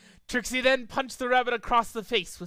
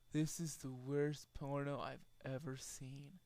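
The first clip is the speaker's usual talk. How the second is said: low, much softer flat remark; deadpan; 5 semitones lower, 16 dB quieter than usual. A narrator's reading. Pinkie Pie watching the fight, low and soft. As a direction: mutter it, unimpressed.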